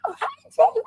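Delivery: very high, starstruck squeak; startled greeting. Retold or imitated. Friend turning to find David behind her, squeaky surprise. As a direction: imitate someone caught off guard by an idol.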